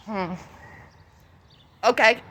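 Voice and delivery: ordinary delivery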